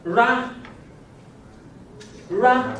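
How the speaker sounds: ra said on a high level tone. Shown as a demonstration. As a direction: lift the pitch on ra. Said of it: "With up accent".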